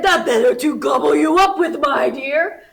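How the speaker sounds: loud booming wolf; wolf pouncing on Little Red; big bad wolf roar. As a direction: let it roar out hungrily. Wolf reveals himself.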